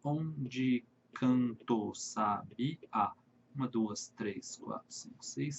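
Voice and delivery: very slow and soft, fading into a near whisper on the count; counting syllables. Shown as a demonstration. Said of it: "No versinho de baixo".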